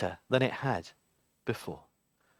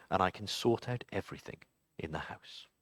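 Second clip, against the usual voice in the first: whispered plea; 14 semitones lower, 14 dB quieter than usual. Acted. The candle whispering its promise to Mary Ann.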